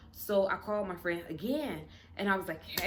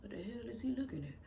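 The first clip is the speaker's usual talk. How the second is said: quick, hushed mutter under the breath; fast private grumble; muttered thought; 8 dB quieter, faster than usual. Retold or imitated. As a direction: say it to yourself, half under the breath. Inner thought about staring stranger.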